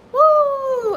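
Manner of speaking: loud, high celebratory whoop; mock whoop. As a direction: whoop like showering cash. Imitating father throwing cash.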